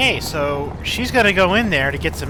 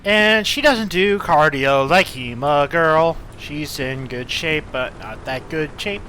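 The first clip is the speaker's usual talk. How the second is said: slow; slower than usual. Real, not acted. Slow commentary on walking.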